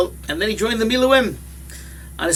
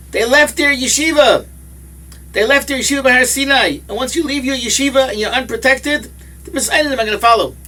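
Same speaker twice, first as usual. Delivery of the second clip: emphatic preaching; 6 semitones higher than usual. Real, not acted. Preacher driving home point.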